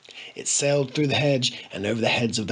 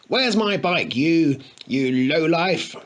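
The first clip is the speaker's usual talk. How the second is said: angry demand; 5 semitones higher, 4 dB louder, slower than usual. A narrator's reading. Harry yelling at the tramp.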